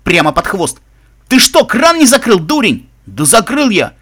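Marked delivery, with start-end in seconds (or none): 1.3-2.9 loud, high-pitched furious accusation; heated, exasperated telling-off; angry scolding. A narrator's reading. Struck guard scolds his mate. 3.1-3.9 raised, louder indignant retort; affronted, forceful protest; indignant bark. A narrator's reading. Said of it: "возмущённо рявкнул второй".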